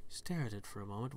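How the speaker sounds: normal talking voice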